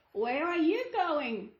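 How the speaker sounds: slow, sly wolf voice. Acted. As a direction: draw the girl in like a sly wolf. Wolf greets the girl.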